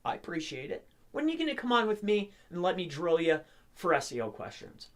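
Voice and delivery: ordinary delivery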